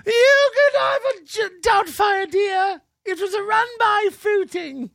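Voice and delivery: very high, put-on granny voice; hammed-up falsetto old woman; squeaky mock old lady. Mocking the caller in a high old lady voice.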